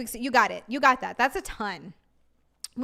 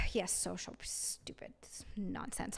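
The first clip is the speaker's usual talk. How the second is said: breathy fed-up whisper; unvoiced grumble of disgust; 5 semitones lower, 16 dB quieter, slower than usual. Real, not acted. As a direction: sigh it out under your breath. Muttering during tax rant.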